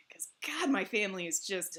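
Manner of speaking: unvoiced whisper about family; whispered aside. Real, not acted. About her exhausting family, whispered aside. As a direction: drop to a confiding whisper.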